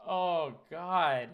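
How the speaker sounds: usual speaking voice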